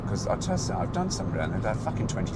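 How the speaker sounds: ordinary delivery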